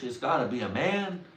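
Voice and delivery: normal talking voice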